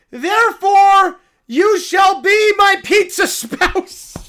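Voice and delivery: high, loud, slow cartoon bear voice; a cub thrilled by the news; excited little bear. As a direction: squeal it like an overjoyed cub. Bear proclaiming.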